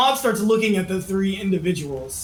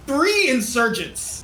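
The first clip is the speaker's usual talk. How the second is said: ordinary talk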